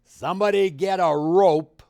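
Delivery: John Wayne. Retold or imitated. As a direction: bark it out like a movie cowboy. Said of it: "And John Wayne said".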